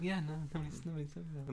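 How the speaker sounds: hesitant mumble, lightly doing Michael Cera; Michael Cera mannerisms. Retold or imitated. Retelling the kid who looked like Michael Cera, soft hesitant mumble. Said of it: "you're doing it again".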